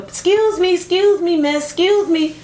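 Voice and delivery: high, urgent repeated call; hailing a stranger, re-enacted; imitated calling out. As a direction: call after someone leaving, pitched high. Acts someone running out calling after her.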